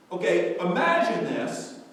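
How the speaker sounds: very loud, high, sustained pulpit cry; full-force preaching. Real, not acted. Preacher pictures elders falling before the throne, full-force preaching. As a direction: build the heavenly scene at full volume.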